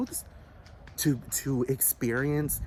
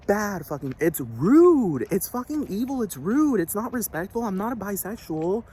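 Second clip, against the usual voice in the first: outraged outburst; 5 semitones higher, 5 dB louder than usual. Real, not acted. Ranting about bad fucking theater.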